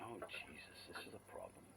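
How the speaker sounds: soft alarmed murmur; hushed worried thought. Retold or imitated. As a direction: mutter the dread under your breath. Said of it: "And I was like".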